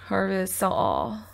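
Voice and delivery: ordinary talk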